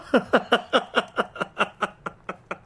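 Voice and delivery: an unhinged laugh that falls away; mad laugh. Acted. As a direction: cackle like a mind coming loose. Madman breaks into laughter.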